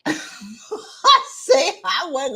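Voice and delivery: real laugh breaking into the words. Real, not acted. Coach owning up to her rant, laughing through it. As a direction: talk through your own laughter.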